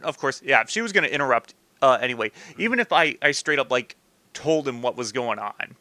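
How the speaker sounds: ordinary talk, nothing marked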